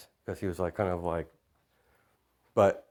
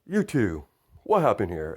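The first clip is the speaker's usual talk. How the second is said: raised-pitch hero's question; calling out; 5 dB louder than usual. Acted. All Might questioning Mina and Jiro, calling out. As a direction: call to them with some authority.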